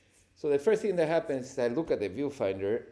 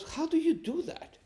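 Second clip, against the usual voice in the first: much higher, astonished coordinator; amazed question. Retold or imitated. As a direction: sound genuinely stunned. Stunt coordinator amazed by shot.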